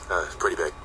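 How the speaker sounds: deadpan, low and soft, utterly unexcited; unconvincing. Retold or imitated. Manager ringing the band with big news, flat and underwhelming. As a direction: mumble huge news like it's nothing. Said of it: "in the most unconvincing way possible".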